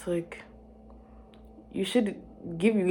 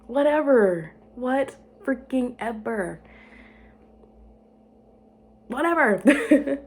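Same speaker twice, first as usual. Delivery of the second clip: louder, higher, drawn-out "whatever"; dramatic dismissive; 6 semitones higher, 5 dB louder, much slower than usual. Pretend. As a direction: toss it off with mock disdain.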